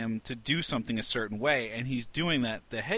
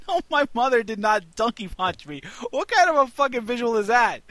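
much higher, louder amused outrage; laughing protest; 11 semitones higher, 7 dB louder than usual. Real, not acted. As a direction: deny it while laughing. Laughing denial of joke.

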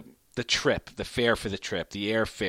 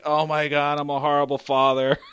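guilty dad; 3 semitones higher, 4 dB louder than usual. Retold or imitated. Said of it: "And I'm like".

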